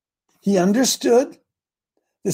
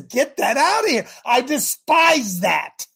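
raised, drawn-out voice of disgust; disgusted rejection; 6 semitones higher, 4 dB louder, slower than usual. Acted. God rejecting a rotting offering, disgusted. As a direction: reject it loudly, stretching the last words.

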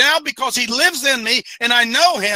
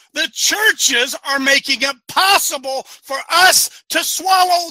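fiery preaching; 5 semitones higher than usual. Acted. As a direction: hammer each point home from the pulpit. Preaching at full force.

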